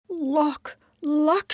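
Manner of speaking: high, loud disbelieving echo; high rising disbelief; incredulous Giddle. Acted.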